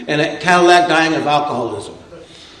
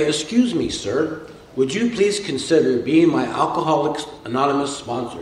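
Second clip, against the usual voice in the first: mock-cordial, lower and softer request; Eddie Haskell; 3 semitones lower, 6 dB quieter than usual. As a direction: lay on fake politeness to a would-be sponsor. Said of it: "real cordial".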